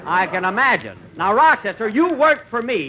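usual speaking voice

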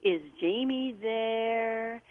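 slow, soft caller stretching out there with a French lilt; imitating a faraway man's voice, slow and stretched; drawn-out ghostly voice. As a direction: draw every word out like a faint, distant caller. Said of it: "the voice extended the syllable there like he had an accent".

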